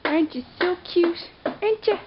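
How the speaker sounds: squeaky praising a dog. Real, not acted. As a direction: gush over a cute puppy. Owner cooing at the dog.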